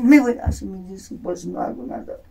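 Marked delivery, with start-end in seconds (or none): 0.0-0.4 loud, higher mock shout of the wife leaving; retold shout. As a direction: shout it like she's storming out. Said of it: "me dijo".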